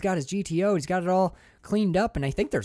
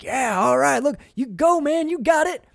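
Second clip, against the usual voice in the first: higher, louder, egging him on; smug go-ahead to the rival; cocky racer; 11 semitones higher, 6 dB louder than usual. Retold or imitated. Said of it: "he's like".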